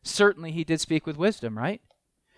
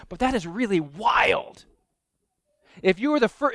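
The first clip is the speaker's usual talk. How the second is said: ordinary talk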